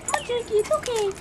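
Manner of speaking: soft, high pet talk; gentle coaxing. Real, not acted. Coaxing Jakey to jump, soft reassurance. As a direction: reassure a hesitant dog in a small sweet voice.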